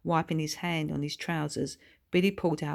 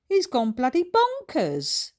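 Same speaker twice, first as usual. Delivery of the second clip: scornful; 8 semitones higher, 5 dB louder than usual. A narrator's reading. Paul scoffs at Billy.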